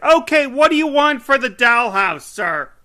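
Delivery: loud, high-pitched British saleslady; mock a shop lady in a shouted British accent; big high British voice, hammed up. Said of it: "gave her a British accent".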